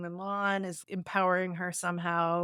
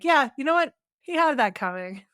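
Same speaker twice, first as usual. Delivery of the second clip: loud, high gleeful cheer; gleeful approval; 5 semitones higher, 5 dB louder than usual. Retold or imitated. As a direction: cheer it on like it's deserved. Voicing own reaction to scene.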